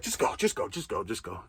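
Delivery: rushed whispered just go; breathless whispered urging; hurried whisper. Retold or imitated. Lady at customs urging him through, whispered and rushed.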